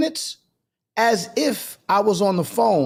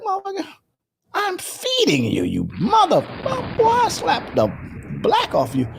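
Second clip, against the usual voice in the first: mock angry rant; 12 semitones higher than usual. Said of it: "I was like".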